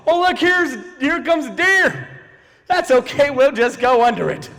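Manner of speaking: high, singled-out cheery driver voice; carefree driver. Acted. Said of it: "and be like".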